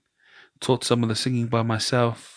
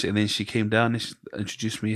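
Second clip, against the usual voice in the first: ordinary talk, nothing marked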